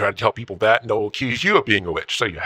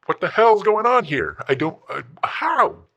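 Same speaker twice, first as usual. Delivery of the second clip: exasperated outburst; 9 semitones higher than usual. Acted. Old man ranting about internet.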